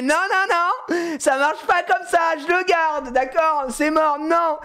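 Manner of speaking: put-on high, stubborn child refusal; put-on high-pitched tantrum; angry little girl. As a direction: imitate a kid digging in. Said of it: "Elle, elle s'énerve".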